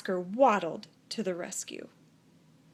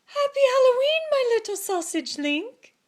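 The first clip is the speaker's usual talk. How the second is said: high, loud, gushing mother voice; doting mother; 13 semitones higher, 7 dB louder than usual. A narrator's reading. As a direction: coo it like a proud mom. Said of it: "said Oscar's mother".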